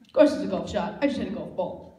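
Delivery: ordinary delivery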